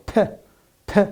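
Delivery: "p, p" said as a puff of breath, the air bursting out; aspirated p. Shown as a demonstration. Teacher making students feel the air of p, aspirated. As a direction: blow out a breathy "p, p". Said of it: "you can feel the air comes out".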